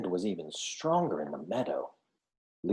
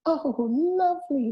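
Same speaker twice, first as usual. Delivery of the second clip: very high, slow squeak of delight; squeaky mice; 5 dB louder, slower than usual. A narrator's reading. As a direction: squeak it like happy little mice. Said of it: "they squeaked".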